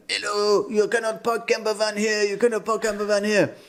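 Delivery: high mock Dutch accent, stiff and clipped. Night watchman telling them to move, mock Dutch accent. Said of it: "Great Dutch impression".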